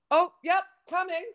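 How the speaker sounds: high, loud breezy "yep, coming"; imitating date's exit. Retelling how her date bailed, a high, loud breezy call. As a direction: toss it off like you're being called away. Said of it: "this guy just goes".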